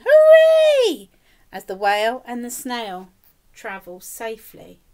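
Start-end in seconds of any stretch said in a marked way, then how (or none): 0.0-1.1 very high, loud hooray; cheering shout. A narrator's reading. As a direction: cheer it out like a whole crowd. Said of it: "villagers shouting".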